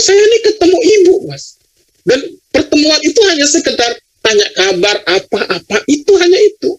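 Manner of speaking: high-pitched, incredulous objection; indignant protest. Real, not acted. Protests being blocked from seeing mother.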